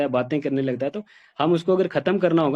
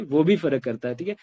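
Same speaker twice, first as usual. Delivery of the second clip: ordinary talk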